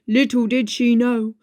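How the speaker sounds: plain reading voice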